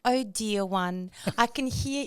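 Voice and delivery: slow, patronizing coo; imagined chatbot voice. Acted. Acting out how her chatbot talks, patronizing. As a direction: stretch it out with mock sympathy. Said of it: "this is the voice that I'm imagining".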